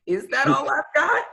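laughing through the question. Retold or imitated. Telling a podcast host, laughing at herself. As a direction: laugh while you ask it. Retelling self-talk, laughing.

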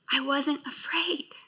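whispered softly, almost to herself; hushed confession of courage. Real, not acted. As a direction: breathe it out in a whisper. Testimony of facing fear.

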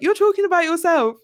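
incredulous high outburst; exasperated. Pretend. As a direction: call her out in disbelief. Exasperated retort to the character.

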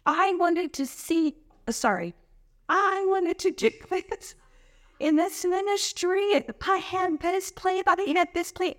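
mock whiny volunteer. Pastor mimicking a volunteer who wanted her own plan. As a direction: drag it out like a pouty volunteer.

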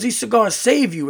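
emphatic brash storyteller; Earl. Retold or imitated. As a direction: imitate a brash guy preaching the virtue of cigars. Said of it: "like, a very brash uncle".

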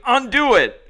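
high, loud angry yell; retold scream. Guy screaming at the prankster chest to chest, angry yell. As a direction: bark the demand right in his face. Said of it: "he was screaming".

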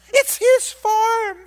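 high, slow, hammed-up crying wail; mock wail. Mock lament over son.

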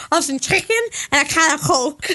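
giggly mock Scouse; Scouse accent. Shown as a demonstration. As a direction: put on a Scouse accent with a laugh in it.

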